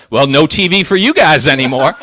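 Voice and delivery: dad banning TV, mock stern. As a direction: lay down the rule with a laugh. Dad punishing, laughing.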